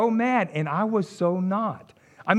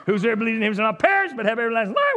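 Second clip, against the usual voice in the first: mock street preaching; 2 semitones higher than usual. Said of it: "I didn't get to say".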